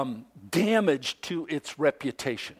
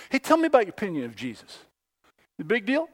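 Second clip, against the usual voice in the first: fast breezy question; 3 semitones higher, much faster than usual. Retold or imitated. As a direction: toss the question off quickly. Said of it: "You ask people in our world today".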